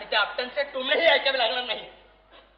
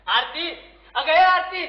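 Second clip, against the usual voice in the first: a loud, higher call across the house; 5 semitones higher, 7 dB louder, slower than usual. Acted. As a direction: shout for her to come. Calling wife loudly.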